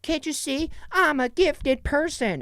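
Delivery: high, theatrical put-on voice; mock self-important whine. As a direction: plead like a misunderstood genius. Mocking a self-important character's plea.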